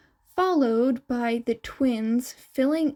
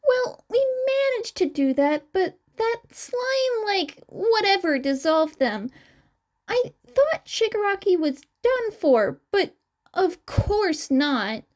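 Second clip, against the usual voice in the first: clearly higher, youthful twin character voice; 9 semitones higher than usual. Acted. Twin explaining to the interviewers, raised higher voice. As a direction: chirp the lines up high like the young twin.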